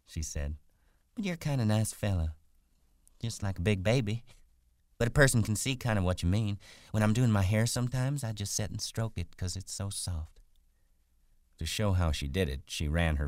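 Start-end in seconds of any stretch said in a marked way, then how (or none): 1.1-10.4 higher country woman voice; friendly, chatty wife. Acted. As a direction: chat along amiably as the wife.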